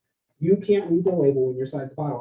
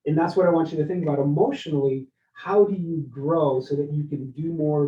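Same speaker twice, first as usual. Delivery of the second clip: ordinary talk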